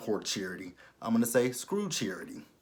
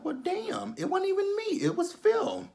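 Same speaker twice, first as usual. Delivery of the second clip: much higher, squeaky mock whine; mock squeaky Judy. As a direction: squeak it out like a sulking daughter.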